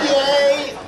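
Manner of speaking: high, loud giggling cheer; excited laugh and squeal. Retold or imitated. Fan meeting his screen dad, giddy laughing yay. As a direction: squeal with delight.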